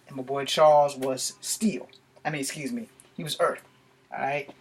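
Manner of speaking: ordinary delivery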